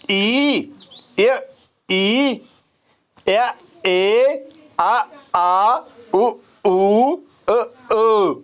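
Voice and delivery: drawn-out single vowel sounds; vowel sound demo. Shown as a demonstration. Teacher sounding vowels slowly for students. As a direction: model each vowel clearly for the class. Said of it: "मैंने यहाँ कहा ए".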